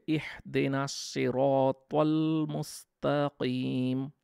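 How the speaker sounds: slow, drawn-out recitation of the verse; slow melodic Quran recitation. Shown as a demonstration. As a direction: recite it slowly with tajweed. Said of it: "ছয় নম্বর আয়াতে বলা হয়েছে".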